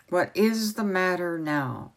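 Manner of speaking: ordinary delivery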